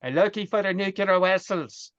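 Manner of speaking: put-on comic film Russian accent. Joking along about spy lines, mock Russian accent. Mimics Star Trek Russian line.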